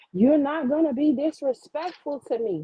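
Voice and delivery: mock-proper scolding parent; changed parental tone. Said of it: "now her whole tone, everything just fucking changes".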